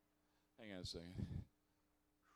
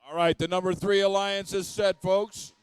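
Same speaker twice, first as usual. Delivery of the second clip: game announcer; 4 semitones higher, 14 dB louder than usual. Real, not acted.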